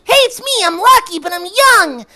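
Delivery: put-on high-pitched child voice, ending in a shout; squeaky kid, excited; young Rocky voice. Shown as a demonstration. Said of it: "shifted Rocky way up here".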